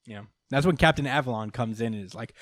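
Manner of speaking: usual speaking voice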